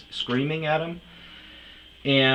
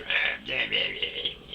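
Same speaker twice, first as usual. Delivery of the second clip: mock grumble; 12 semitones lower, slower than usual. Mocking the old guy's bitching.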